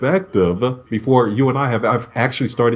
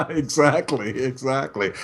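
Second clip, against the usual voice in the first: softer, laughing through the word; 2 semitones higher, 6 dB quieter than usual. Real, not acted. Guest laughs agreeing with host's joke, softly laughing.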